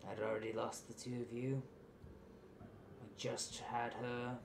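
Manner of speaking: halting confession with long pauses; remorseful father. Acted. Game master voices remorseful father explaining.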